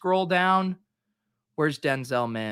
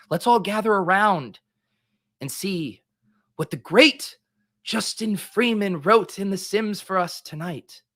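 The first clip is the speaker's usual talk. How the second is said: preaching to the flock, higher; cult leader; 4 semitones higher, 3 dB louder than usual. Pretend. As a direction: summon the faithful like a cult leader. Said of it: "I sound like this, this cult leader".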